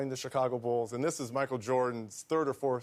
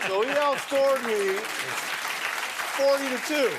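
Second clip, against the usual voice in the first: incredulous punchline; 12 semitones higher, 6 dB louder than usual. Real, not acted. Laughing punchline of story.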